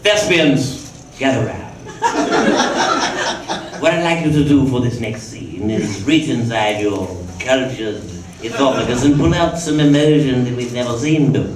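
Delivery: lightly voiced, grandiose theatre director; a hint of a grand old-school director addressing actors; Mike Newell impression. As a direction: play it as a mildly pompous stage director. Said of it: "who says things like".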